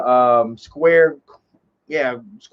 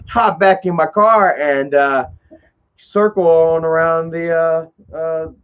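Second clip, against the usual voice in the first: ordinary talk, nothing marked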